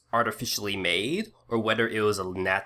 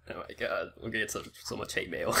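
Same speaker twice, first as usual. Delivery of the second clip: laughing; 7 dB quieter, faster than usual. Real, not acted. Joking about viewer backlash.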